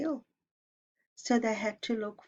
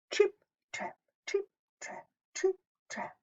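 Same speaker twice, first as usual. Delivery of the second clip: high tiptoeing whisper, fading softer; whispered trip trap; 12 semitones higher, 4 dB quieter than usual. A narrator's reading. Teacher whispering the middle goat's hoofsteps to the children. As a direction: tiptoe the words over the bridge. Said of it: "hooves tiptoe over bridge".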